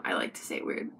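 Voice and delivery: breathy whispered confession; whispered aside. Real, not acted. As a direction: whisper it to yourself like a secret. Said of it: "confession in an aside".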